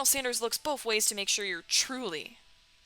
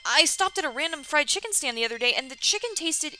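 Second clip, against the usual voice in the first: higher, hammed-up character voice; eager Marty; 6 semitones higher, 3 dB louder than usual. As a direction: play the keen player character, pitched up. Plays Marty replying to the Colonel.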